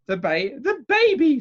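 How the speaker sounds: high, loud, exasperated correction; insistent. A narrator's reading. Aunt correcting the doctor about whom she means, insistently. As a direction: hammer it out, pitched right up. Aunt demands news of baby.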